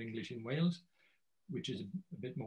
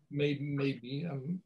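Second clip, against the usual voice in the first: ordinary delivery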